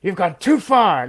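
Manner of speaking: lightly voiced [name], slow and drawn out; a slow menacing [name] line, half-hearted; [name] impression. Podcaster mimics [name]'s slow menacing line. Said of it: "all I can hear is [name] going".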